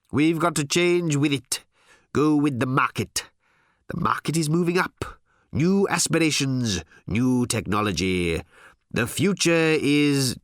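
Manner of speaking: high, emphatic sales pitch, slowing down; pompous executive speechifying; corporate visionary. Acted. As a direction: sell the future with slow grandeur.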